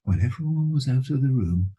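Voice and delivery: ordinary delivery, nothing marked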